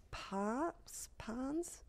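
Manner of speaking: soft, rising, questioning guess; unsure guess. Real, not acted. Podcast host guessing at an author's surname, tentative. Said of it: "can't remember her last name".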